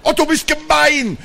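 high, indignant wail; crying out. A narrator's reading. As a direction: protest loudly at being teased. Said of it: "rief Papa".

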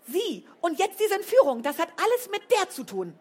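high, loud furious shout; furious yell. A narrator's reading. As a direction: scream the accusation. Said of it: "dass sie brüllte".

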